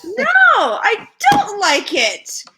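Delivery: high, loud, slow refusal; emphatic protest. Retold or imitated. Model admitting she dislikes modeling, emphatic protest. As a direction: push each word out high and loud. Acting out complaint about modeling.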